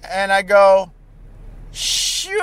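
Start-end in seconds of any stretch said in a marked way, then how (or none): 1.7-2.4 high, soft, long-drawn yes; evasive fib. Retold or imitated. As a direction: stretch it out high, dodging an open lie. Acting his evasive fib.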